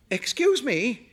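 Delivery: high, loud, flat-toned woman's voice; toneless, shrill. A narrator's reading. Restaurant guest accosting the chef, high and shrill. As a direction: snap out a shrill, toneless excuse me. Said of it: "her voice toneless and shrill".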